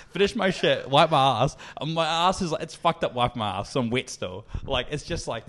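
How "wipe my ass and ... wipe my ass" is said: ordinary delivery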